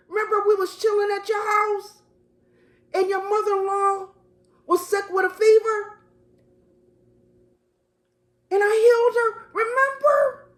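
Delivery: pleading Jesus to Peter. Acted. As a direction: call out to an old friend, begging him to remember.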